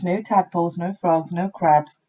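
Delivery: plain reading voice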